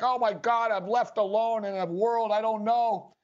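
imitating a crying robot left behind; lightly voiced Bender wailing he's alone; mock robot crying. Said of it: "Bender starts crying and he's like".

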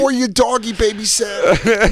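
put-on high, bragging little kid. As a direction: hustle like a young kid. Mocking kid nephews.

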